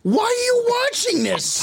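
high slow disbelief; shocked. Real, not acted. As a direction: ask it high and appalled. Shocked at the story.

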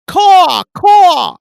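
high, loud crow caw; squawking caw. Pretend. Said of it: "really hit it".

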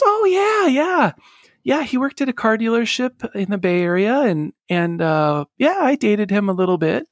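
high-pitched imitation of mom remembering; casual recollection. Mom recalling an old boyfriend when asked, casual recollection. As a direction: start on a very high "oh yeah", then ease down.

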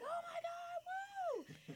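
high-pitched screaming cheer; excited scream. Retold or imitated. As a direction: shriek with crowd excitement. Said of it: "we're all screaming".

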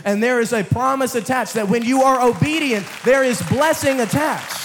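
loud, high climax; full-force preaching. Acted. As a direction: lift the room to blessing.

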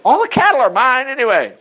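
high, emphatic declaration; God laying claim. Acted. Voices God claiming the cattle.

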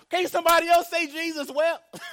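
put-on loud childish protest at the table; exasperated kid. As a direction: whine like a fed-up little brother. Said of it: "And I was like".

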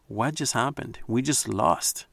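ordinary talk

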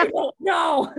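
high, loud mock bewilderment; baffled husband. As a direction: mimic a husband at a total loss. Husband's baffled reply retold.

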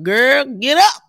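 rally yourself like a firm friend; pep talk. Retold or imitated. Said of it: "I was pep talking myself".

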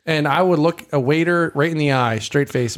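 normal talking voice

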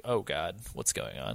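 low, soft imitation of moaning; mock moaning. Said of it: "even her moaning is like".

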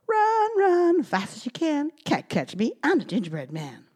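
gingerbread man voice. Acted. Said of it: "And he laughed and said".

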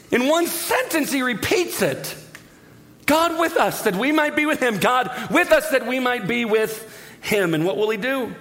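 very high, emphatic proclamation; excited preaching climax. Real, not acted. Preacher hammering home the repeated promise, high excited proclamation. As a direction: cry it out to the whole congregation.

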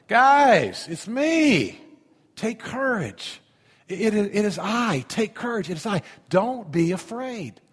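a hint of a calm Jesus calling over the waves; Jesus reassuring. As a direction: call out gently to scared friends. Pastor voicing Jesus reassuring.

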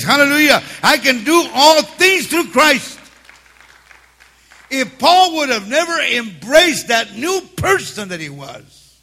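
fervent preaching. Real, not acted. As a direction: drive every line home to the church.

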